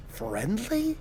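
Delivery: hushed, questioning disbelief; small, puzzled question; soft incredulity. A narrator's reading. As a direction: say it quietly, as if barely believable. Devil's incredulous reluctant admission.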